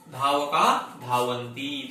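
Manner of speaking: ordinary talk, nothing marked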